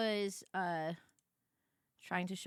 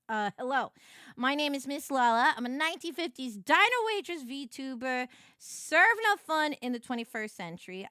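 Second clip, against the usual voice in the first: a hint of a cheery waitress voice; Miss Lala voice; 4 semitones higher, 8 dB louder than usual. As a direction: introduce yourself like a peppy waitress.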